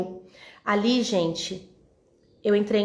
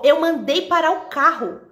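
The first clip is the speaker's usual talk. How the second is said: ordem firme; 6 semitones higher, 4 dB louder than usual. Retold or imitated. Ladrão ordena parar.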